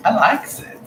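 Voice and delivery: high, silly "I likes it"; mock dopey voice; goofy voice. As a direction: play it as a cartoon rube. Playful vulgar comment.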